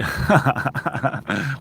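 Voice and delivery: rapid chuckling ha-ha-ha; real burst of laughter; laughing. Real, not acted. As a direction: laugh in a quick even run. Laughs at a comment.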